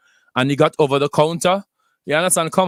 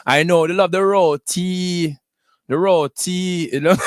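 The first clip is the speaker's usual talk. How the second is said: put-on a slow, clumsy Trinidadian accent, pitched higher; Trini accent attempt; 4 semitones higher, slower than usual. Radio host playfully faking a Trinidadian accent for his Trini listeners. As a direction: try a Trini lilt on roti and fail a little. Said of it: "I'm terrible at the accent".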